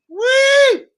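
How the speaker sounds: sarcastic falsetto woo; mock cheer. Scoffing at top-four ambition to Manny, mock whoop.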